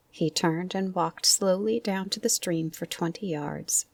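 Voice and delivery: ordinary delivery, nothing marked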